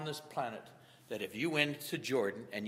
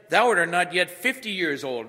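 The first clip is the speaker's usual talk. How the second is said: louder and higher, pressing the point; loud preaching; 3 semitones higher, 7 dB louder than usual. Acted. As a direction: preach it out to the back row. Preacher voicing the mocking crowd.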